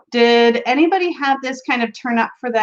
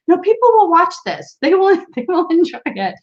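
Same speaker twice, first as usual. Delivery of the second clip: a friend's bright nudge, raised pitch; quoting a friend; 7 semitones higher than usual. Retold or imitated. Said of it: "She's like".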